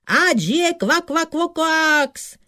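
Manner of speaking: very high, loud squealed cheer. A narrator's reading. Frogs hail their hero Kvak, high jubilant cheer.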